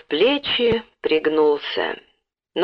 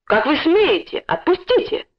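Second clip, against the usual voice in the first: indignant cry; 9 semitones higher than usual. A narrator's reading. Boy struggles to break free, high indignant cry. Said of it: "закричал он".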